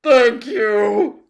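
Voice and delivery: loud, high, woeful groan; groaning thanks. A narrator's reading. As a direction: groan out thanks through misery. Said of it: "groaned the din".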